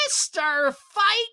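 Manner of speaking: high, slow, stretched-out fighter introduction; ring announcer. Acted. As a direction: draw out the name like an announcer.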